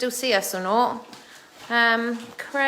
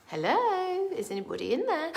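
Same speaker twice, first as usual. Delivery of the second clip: talking to pets; 6 semitones higher, 5 dB quieter than usual. Real, not acted. Calling into glider bed.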